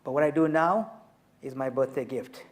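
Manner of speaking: ordinary talk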